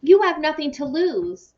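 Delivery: high, loud outburst that slows at the end; exploding. A narrator's reading. Jason exploding at Mia about his parents. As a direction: burst out in frustration. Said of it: "he exploded".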